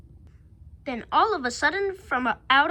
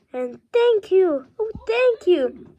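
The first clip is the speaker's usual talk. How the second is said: higher, slow heartfelt thanks; grateful; 4 semitones higher, 4 dB louder, slower than usual. Acted. Witch thanking her friends after the rescue, grateful. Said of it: "the grateful witch cried".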